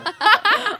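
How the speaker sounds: loud laugh. Real, not acted. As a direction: burst of high loud laughter. Friend's impression was spot on.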